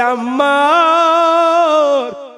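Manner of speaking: high, very long-drawn call. Retold or imitated. Preacher voicing mother calling her son, drawn-out calling out. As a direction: stretch the name into a sung, high call. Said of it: "সন্তানকে ডেকে বলে".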